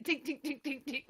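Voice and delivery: high, soft rapid dings; ping them out lightly; little comic plucking sounds; tiny twangs. Retold or imitated. Imitates dad plucking the hair.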